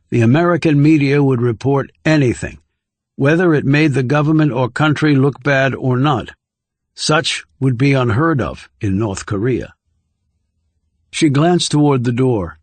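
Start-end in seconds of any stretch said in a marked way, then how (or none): none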